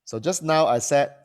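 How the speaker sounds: usual speaking voice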